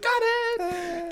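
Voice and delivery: high mocking voice; high-pitched sarcastic acknowledgment; mock "got it". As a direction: squeak it out like a kid told off. High mock voice recalling instruction.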